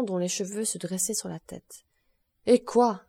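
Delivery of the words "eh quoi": loud, mildly acted outcry; exclaiming. A narrator's reading. Judge reacts to the prosecutor's confession, exclaiming. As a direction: break in loudly, as a storyteller voicing a judge. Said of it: "s'écria le président".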